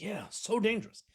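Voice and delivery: high-pitched mock alarm; sarcastic agreement. Commentator mocks Trump's claim about rampant crime, sarcastic. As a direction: pretend to agree, dripping with sarcasm.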